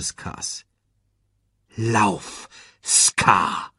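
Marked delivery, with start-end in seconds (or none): 1.8-3.7 commanding. A narrator's reading. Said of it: "befahl Simba".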